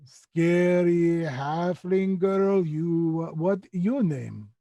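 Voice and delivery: slow, drawn-out creature voice; Skitch, simple creature. Acted. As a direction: announce it in stretched broken speech.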